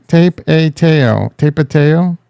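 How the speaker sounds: Tape a Teo slowly as TAPE-ay-TAY-oh; long a sounds in Tape a Teo; sounded-out mispronunciation. Shown as a demonstration. Streamer testing options, Tape a Teo sounded out then joined. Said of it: "Is it Tape a Teo".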